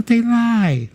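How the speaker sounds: very high falsetto impression. Star denying the stories in an interview, high falsetto impression. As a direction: protest in a put-on high voice. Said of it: "I don't do that great with [name] voice".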